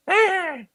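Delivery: high goofy hyena cackle; hyena laugh. Pretend. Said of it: "that goofy laugh".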